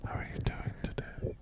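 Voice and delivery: soft whispered interview question; voiceless, close-mic small talk; whispered podcast voice. Shown as a demonstration. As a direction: ask it in a hushed host whisper. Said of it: "Talk like this".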